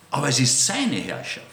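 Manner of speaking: "seine" clearly stressed in "Aber es ist seine Herrschaft"; the emphasis is on whose rule it is. Shown as a demonstration. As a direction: drive home "seine" with a falling tune. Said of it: "worauf ich Betonung lege".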